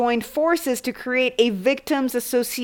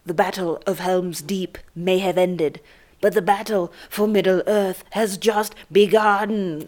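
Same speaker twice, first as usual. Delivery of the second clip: lightly voiced, slow movie-trailer narrator; a touch of grand fantasy announcer; epic trailer voice; 4 semitones lower than usual. Mock epic movie quote.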